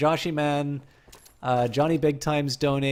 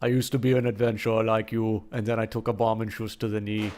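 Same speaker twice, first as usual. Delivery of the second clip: put-on lower-pitched German-accented deadpan; 4 semitones lower than usual. Streamer quoting a game line, low German accent. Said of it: "say it in a German accent".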